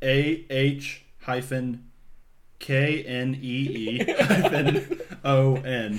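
slow, letter by letter; spelling the word out slowly, letter by letter. Shown as a demonstration. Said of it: "spelling it out".

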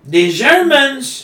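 mock dramatic shout. Mock dramatic game line.

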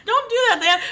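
high, loud protest; excited protest. Real, not acted. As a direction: squeal it out in protest. Protesting friend's teasing.

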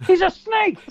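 high, loud outraged exclamation; exclaiming. Real, not acted. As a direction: blurt it out in disbelief.

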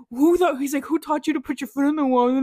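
loud angry dad voice. Retold or imitated. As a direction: scold like a mad father. Angry dad in retold story.

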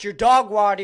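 ordinary delivery

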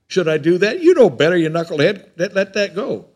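loud, high scolding of himself; mock self-scolding. Preacher scolding his own past self, a loud mock rebuke. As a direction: scold yourself like a knucklehead.

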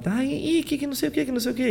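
high mocking whine of Shun's lines; high whimpering sibling voice, mocking; whiny Shun impression. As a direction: squeak it out like a crying kid. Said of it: "Mas ele fala".

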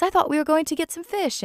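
put-on high boy's voice, mildly let down; boy reminds the nanny of the plan, high mild whine; Michael's complaint. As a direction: whine it lightly like a disappointed boy. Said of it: "Michael says".

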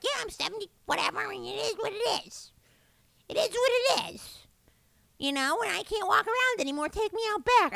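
high-pitched impression of an elderly mother; imitating mom. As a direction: play the unbothered mother shrugging it off. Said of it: "I feel she's like".

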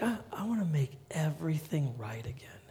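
whispered, soft longing promise; tender whisper. Pretend. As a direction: breathe it gently as God. God voiced tenderly.

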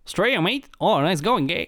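high-pitched, put-on Aussie banter; mock Australian accent. Two friends trade fake Aussie greetings, mock Australian accent. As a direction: exaggerate an Australian accent playfully.

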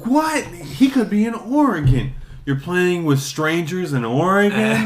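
high, shocked mom; mock mom alarm. Mom shocked about Oregon strangers, high mock alarm. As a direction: gasp it like a panicked mom. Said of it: "kid and mom argue".

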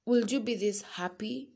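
ordinary talk, nothing marked

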